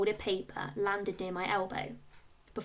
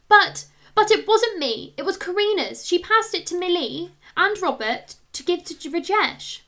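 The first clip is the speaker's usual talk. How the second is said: put-on high, loud, rushed child's protest; boy blurting out blame to the teacher; panicked protest; 8 semitones higher, 9 dB louder than usual. A narrator's reading. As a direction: plead your innocence in a panic.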